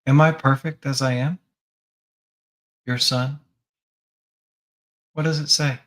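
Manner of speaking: ordinary talk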